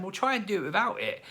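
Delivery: high put-on parental voice; imitating self as parent. Parent advising son, put-on voice.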